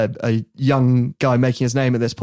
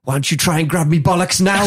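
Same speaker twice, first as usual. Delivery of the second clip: cheeky taunt; 4 semitones higher than usual. Retold or imitated. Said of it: "he's like".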